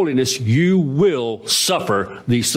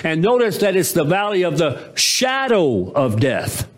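normal talking voice

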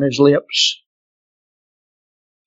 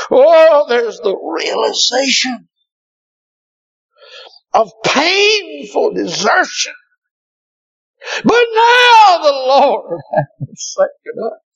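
anguished preaching; 10 semitones higher than usual. Acted. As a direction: wail it out over the people.

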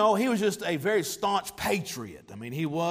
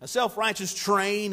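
normal talking voice

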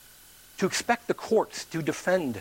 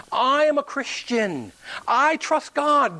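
slow, higher-pitched mock testimony; confident declaration; 8 semitones higher, slower than usual. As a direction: declare it grandly, drawing out the words. Stubborn man declares faith to police.

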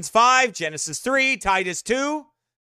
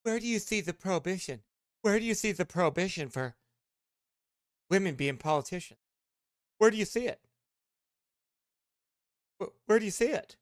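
mocking whine; 8 dB quieter, faster than usual. Preacher mimicking the other pastor's question, mocking and much softer. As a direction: repeat his question like a petulant simpering fool.

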